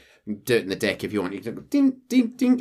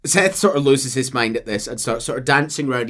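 usual speaking voice

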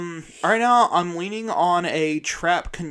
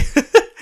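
laughing; 11 semitones higher, 5 dB louder than usual. Real, not acted. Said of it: "I laughed too hard at that".

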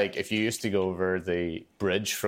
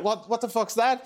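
much higher, shocked cry; shocked townsfolk; 14 semitones higher than usual. Retold or imitated. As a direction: gasp it out in disbelief. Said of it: "everybody just went like".

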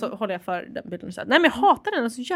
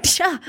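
shock yelp; 7 semitones higher than usual. Retold or imitated. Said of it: "Låter det som att jag får en stöt?".

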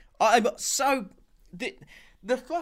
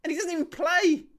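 exasperated rant; 10 semitones higher than usual. Real, not acted. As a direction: scold the rival fans in disbelief. Ranting at Arsenal fans.

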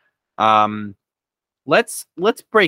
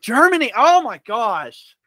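surprised; 12 semitones higher, 8 dB louder than usual. Real, not acted. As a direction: react in shocked disbelief. Learns surprising correct answer.

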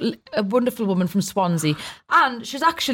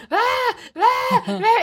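high mock shriek at a bee; imitated child scream; 13 semitones higher, much slower than usual. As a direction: squeal like a kid scared of a bee. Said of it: "someone was screaming at a bee".

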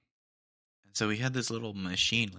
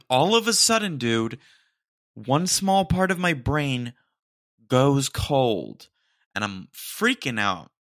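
loud, higher, slowed retelling of panic; building to a loud, high alarmed telling; loud freaked-out recounting; 4 semitones higher, 9 dB louder, slower than usual. Real, not acted. Retelling panic, brain goes cold.

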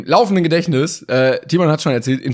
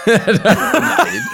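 laughing no; 12 semitones higher than usual. Real, not acted. Repeats the refusal, laughing.

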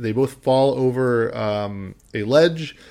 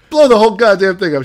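loud, high, fast mock yell; retold shout; 9 semitones higher, 11 dB louder, faster than usual. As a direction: bellow the bluff like a movie cop. Retold man shouting the threat.

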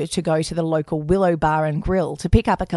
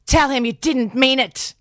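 yelling; 7 semitones higher than usual. Retold or imitated. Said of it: "yelling at Joe".

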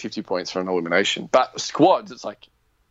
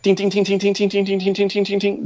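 high, rapid run of dings; ping like bullets bouncing off armor; ding-ding-ding on one high note; imitated bullet pings; 10 semitones higher than usual. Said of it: "it was just like".

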